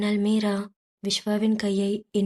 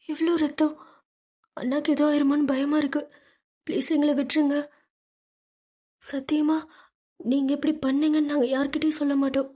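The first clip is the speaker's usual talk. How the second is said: higher, tearful pleading; crying, begging; 6 semitones higher, faster than usual. A narrator's reading. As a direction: beg the thugs through tears. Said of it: "அழுது கொண்டே".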